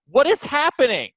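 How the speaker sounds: high, loud cry of disbelief; exasperated outcry. Real, not acted. Podcaster baffled by the coach's punch clock, exasperated. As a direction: burst out, incredulous.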